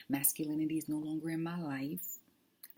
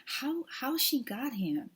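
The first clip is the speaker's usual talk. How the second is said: mock incredulous asker; 8 semitones higher, 4 dB louder, slower than usual. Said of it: "Somebody asked my friend Cynthia".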